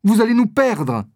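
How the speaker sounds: crier l'avertissement, haut et fort; cri affolé. Acted. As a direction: lancer l'alarme d'une voix aiguë et forte. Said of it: "s'écrie Constance". (Constance avertit le duc en criant, aigu et fort)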